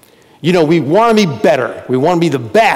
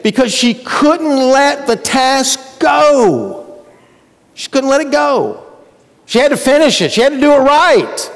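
frustrated Martha; 9 semitones higher than usual. Acted. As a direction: voice Martha's tense frustration, pitched up. Acting Martha's inner frustration.

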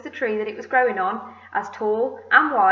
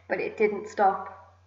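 plain reading voice

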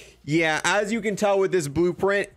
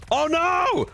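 startled cry; 13 semitones higher than usual. Real, not acted. Sudden setback in game.

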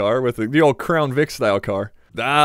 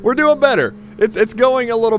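pleased, excited; 7 semitones higher, 4 dB louder than usual. Real, not acted. Pleased after a clean pass.

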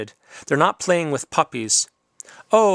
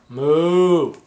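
a loud, hammed-up moo; cow moo; 4 dB louder than usual. Man reading a farm picture book, mooing loudly.

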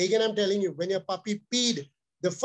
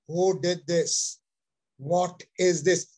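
low, slow, short rebuke; rough scolding tone; 3 semitones lower, slower than usual. Shown as a demonstration. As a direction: growl it out slowly, low. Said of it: "changing your tone of voice in a rougher tone".

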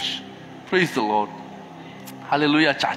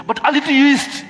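loud refrain; 12 semitones higher, 11 dB louder than usual. Acted. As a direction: shout the refrain high and loud. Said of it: "preacher repeats the refrain".

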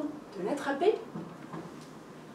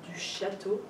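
plain reading voice

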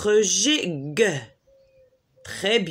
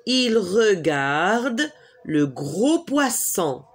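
"Il regarde le gros poisson" said slowly with "gros" stressed; slow model answer; slower than usual. Shown as a demonstration. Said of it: "Il fait quoi?".

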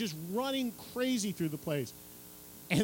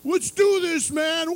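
much higher, loud party yell; wild rocker hollering; rowdy rocker Zach; 11 semitones higher, 8 dB louder, slower than usual. Retold or imitated. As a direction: burst in drunk and hyped.

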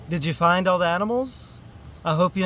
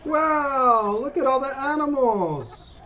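wide-eyed wonder; 9 semitones higher than usual. Pretend. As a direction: marvel aloud for young listeners. Marvels at all the animals.

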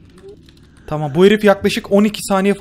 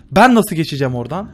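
louder frustrated; 6 dB louder than usual. Real, not acted.

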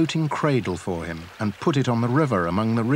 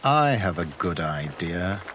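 scheming king; 6 semitones lower than usual. A narrator's reading. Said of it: "said the king".

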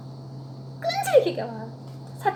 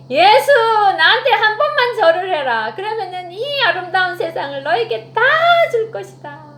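loud, higher taunting devil voice; 사탄의 유혹; 5 semitones higher, 6 dB louder than usual. Acted. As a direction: tempt him like a swaggering devil. 사탄이 절하라고 유혹함. (크고 높게 비아냥대는 사탄 목소리)

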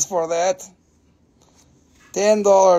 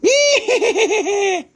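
high, loud, rapid cackling laugh; showy cackle; 13 semitones higher, 5 dB louder than usual. Pretend. Seller reminding viewers just in case, high cackle. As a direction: let out a long, piercing cackle. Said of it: "just in case you forgot".

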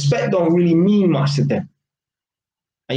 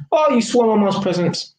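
mock indifference; 7 semitones higher than usual.

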